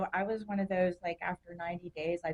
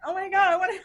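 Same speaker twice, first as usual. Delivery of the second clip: high, louder laughing oh my God; laughing exclamation; 13 semitones higher, 10 dB louder, much faster than usual. Retold or imitated. Watching elderly helpers struggle, high loud laughing outburst. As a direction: burst out laughing at herself. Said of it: "and I'm like".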